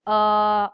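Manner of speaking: long held "uh" on one pitch; drawn-out hesitation filler "uh"; vocalized pause. Shown as a demonstration. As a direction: fill the silence with a loud, level "uh". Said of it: "vocalized pauses ... such as".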